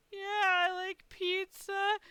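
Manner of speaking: high, slow wail; plaintive upset. Retold or imitated. Guest telling front desk lady she's starving, high plaintive voice. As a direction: whimper it out like a hungry, upset child.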